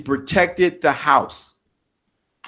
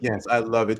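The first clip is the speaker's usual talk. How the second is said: ordinary delivery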